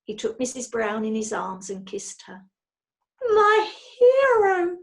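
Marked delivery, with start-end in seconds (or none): 3.2-4.8 very high, loud swooning gasp; gasped adoration. A narrator's reading. Wife swooning as husband heads out, breathy gasp. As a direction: swoon over him breathlessly. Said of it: "she gasped".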